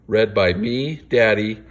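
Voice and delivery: usual speaking voice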